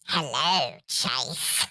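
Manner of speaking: put-on low, snide British villain accent, dropping at the end. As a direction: greet him like a smug British villain. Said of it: "like, villain accent".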